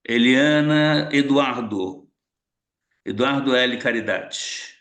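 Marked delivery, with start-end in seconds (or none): none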